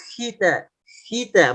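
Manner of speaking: "khita" softened to a light "hita"; too thin kha. Shown as a demonstration. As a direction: say "khita" with a weak h-like kh. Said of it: "maka itu terlalu tipis". ("khita" terlalu tipis seperti "hita")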